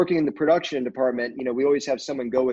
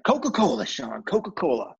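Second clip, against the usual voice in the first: Gary Busey; 2 semitones higher than usual. Retold or imitated. Actor answering a young crew member's drink offer, Texas-accented impression. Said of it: "Texas accent".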